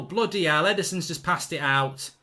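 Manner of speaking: ordinary delivery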